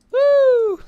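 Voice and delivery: high, loud whoop of relief; excited whoop. Real, not acted. Player shaking off a scare, whooping high and loud. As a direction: let out a big relieved whoop.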